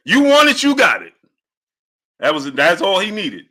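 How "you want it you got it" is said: you want it yelled high and loud; hyped shout. Retold or imitated. Streamer answers Boss Red with a hyped shout. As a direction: belt it like a fired-up fighter. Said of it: "Hey, Boss Red".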